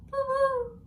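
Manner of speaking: very high, loud little chirp; baby dragon squeak. Acted. Baby dragon answering her mother, squeaky. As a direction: squeak like a tiny pink dragon. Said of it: "said Fodla".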